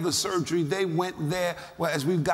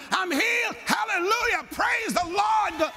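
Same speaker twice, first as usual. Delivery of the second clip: shouting; 13 semitones higher than usual. Retold or imitated. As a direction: shout your praise to the ceiling. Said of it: "I'm just shouting".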